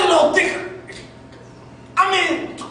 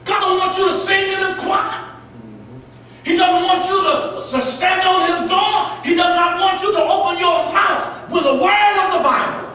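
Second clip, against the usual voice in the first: forceful preaching; 3 semitones higher than usual. Acted. Pastor warning the church about obligation, forceful preaching. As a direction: drive it home from the pulpit.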